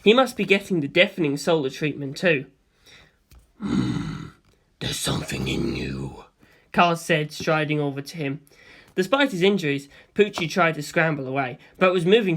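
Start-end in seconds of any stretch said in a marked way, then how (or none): 3.6-6.2 much lower and softer, slow whisper; low, slow whispered monster voice; cold ancient being. A narrator's reading. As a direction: breathe the question in calm interest. Cars voice.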